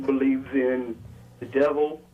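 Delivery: normal talking voice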